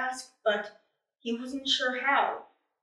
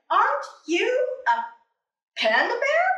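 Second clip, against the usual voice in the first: puzzled question; 9 semitones higher, 4 dB louder than usual. Acted. Puzzled panda.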